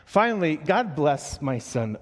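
normal talking voice

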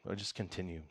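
normal talking voice

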